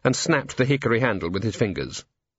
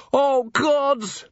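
high, pained exclamation; man on the roof in despair; despairing groan. A narrator's reading. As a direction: groan in dread. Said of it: "muttered Colon".

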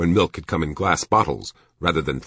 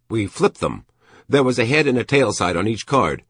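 put-on lively, higher voice for a man recalling childhood; reminiscing shopkeeper; 7 semitones higher, 4 dB louder than usual. A narrator's reading. Shopkeeper explaining a childhood game to the major, animated reminiscence. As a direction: explain the old game eagerly.

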